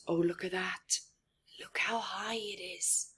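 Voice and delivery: soft, gasping whisper; whispering in awe at the climb; awed whisper. A narrator's reading. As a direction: marvel at the height under your breath. Watching mouse climb.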